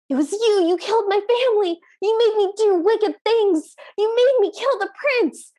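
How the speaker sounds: high-pitched, hammed-up accusation; acted accusation. Tranbang accusing the magistrate who killed his family, mock outrage. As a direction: pile up the charges like a wronged movie character.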